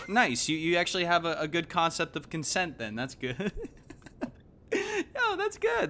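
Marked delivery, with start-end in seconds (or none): 4.7-5.9 mock impressed. Real, not acted. Streamer teasing a viewer without Twitter, in a high mock-impressed squeal. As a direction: jump way up high in mock amazement.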